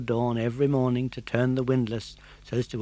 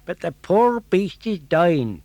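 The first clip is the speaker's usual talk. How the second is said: gardener voice; 6 semitones higher, 8 dB louder than usual. Acted.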